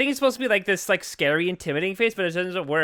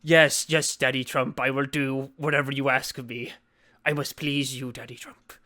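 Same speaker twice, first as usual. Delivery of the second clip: low, softening obedient voice; entranced servant; 5 semitones lower, 3 dB quieter than usual. Acted. Obeying the mental command, mock entranced servant. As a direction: answer submissively, sinking lower and softer.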